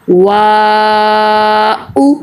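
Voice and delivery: वा held very long, then a separate short उ; long steady aa in वा, distinct final उ; correct elongated reading. Shown as a demonstration. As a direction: stretch वा on a level note, finish with a brief उ. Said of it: "सही पढ़ने का तरीका क्या है".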